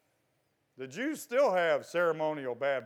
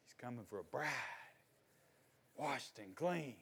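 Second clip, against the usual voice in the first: very soft, lowered, spaced-out phrases; hushed solemn preaching; 5 semitones lower, 16 dB quieter than usual. Real, not acted. Preacher telling church of the coming bridegroom, hushed. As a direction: let it fall nearly to a murmur. Hushed line after shouted rebuttal.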